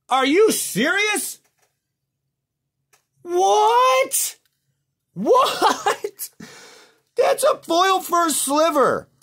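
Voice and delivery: high, loud screams of disbelief; shocked yelling. Real, not acted. Host finds he axed a valuable card, shocked screaming. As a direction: shriek it slowly, stretched out in horror.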